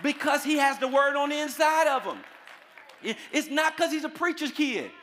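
high, loud sustained pulpit proclamation; full-force preaching. Real, not acted. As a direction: drive each phrase out to the congregation.

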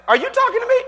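high, squeaky-pitched comic reply; put-on high voice rising at the end; incredulous question. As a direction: ask it way up high, as if astonished. Man answers the parrot's call.

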